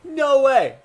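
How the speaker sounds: a gamer's excited disbelief. Real, not acted. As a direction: blurt out No way, way up high. Disbelief before a risky play.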